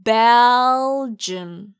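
Belgium held long and loud on BEL; slow loud repeat-after-me Belgium; drawn-out model. Shown as a demonstration. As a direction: stretch Belgium for students to repeat. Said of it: "그럼 함께 말해볼까요".

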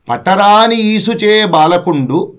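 poem recitation. Acted.

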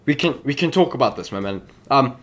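ordinary delivery

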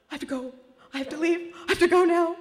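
bolting from the dinner table in a panic; panicked. Retold or imitated. Woman fleeing her date's little gray box, panicked plea. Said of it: "I was in a panic".

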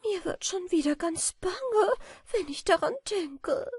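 high, anxious butterfly lament; a frightened butterfly fretting; understated high sadness; sad. A narrator's reading. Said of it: "rief Notburger traurig aus".

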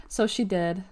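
plain reading voice

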